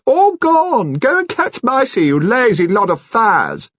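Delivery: very high, loud, fluting alto; curious alto voice. A narrator's reading. Mister Finn shooing his cats in a high alto. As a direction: sing it out like a fussy old aesthete. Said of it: "in his curious alto voice".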